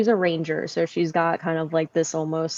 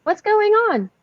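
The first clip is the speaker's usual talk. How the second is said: raised, higher questioning call; 7 dB louder, slower than usual. Acted. Twyla answers the shouting from below, calling out. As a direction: call out to someone outside. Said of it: "as I kinda call out".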